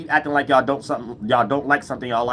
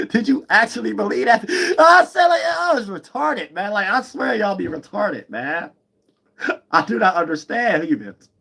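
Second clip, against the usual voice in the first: high-pitched, laughing incredulity; laughing disbelief; 9 semitones higher, slower than usual. Real, not acted. Mocking men who believed her, in high laughing disbelief. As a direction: let laughter push the voice way up.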